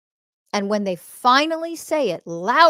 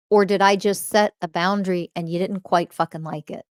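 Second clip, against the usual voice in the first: ordinary delivery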